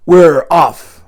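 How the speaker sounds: loud cheer from the men; men's shout. Acted. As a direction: shout it as they set off. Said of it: "the men shouted".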